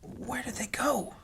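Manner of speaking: breathless whisper in the dark hold; slow, hushed searching whisper. Acted. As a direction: whisper it so no one hears. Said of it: "groping with his hands as he explores the hold".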